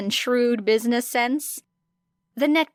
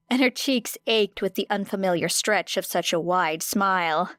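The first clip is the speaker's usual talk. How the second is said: plain reading voice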